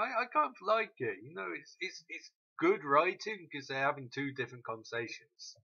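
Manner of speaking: ordinary talk, nothing marked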